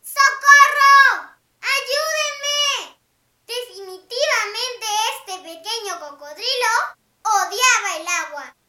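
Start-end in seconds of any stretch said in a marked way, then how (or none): 0.0-3.0 loud panicked yell; cry for help. Acted. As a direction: shout for help. Crocodile drowning cries.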